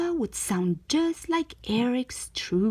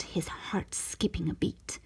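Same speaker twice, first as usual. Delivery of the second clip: ordinary delivery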